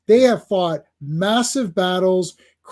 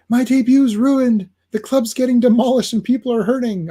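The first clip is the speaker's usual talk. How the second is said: higher-pitched distressed heroine; badly faked wail of dismay, pitched up; Dazzler; 5 semitones higher than usual. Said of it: "I can't do Dazzler's voice".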